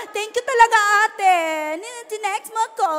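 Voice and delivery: cheerful thanks, a touch overplayed; happy thanks. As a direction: gush a little thank-you to the cell leader. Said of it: "Kung masaya ka".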